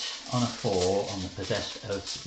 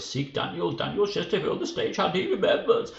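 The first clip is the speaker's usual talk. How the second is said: high, strained monster voice rising higher; cracked voice; 8 semitones higher, 5 dB louder than usual. A narrator's reading. Wheezing monster tells who to seek, cracked voice. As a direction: cry it out in a cracked, high creature voice. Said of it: "says in a cracked voice".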